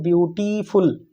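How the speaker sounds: Beautiful segmented into three beats; beautiful split as view, टी, फुल; broken into syllables. Shown as a demonstration. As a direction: stop after each syllable of beautiful. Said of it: "तीन या उससे ज़्यादा बार आप रुक रहे हो".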